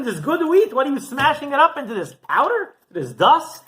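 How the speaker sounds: high, loud and half-laughing; a hammed-up outraged yell with a laugh in it; mock yell. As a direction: yell at him in laughing disbelief. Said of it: "he starts yelling at him".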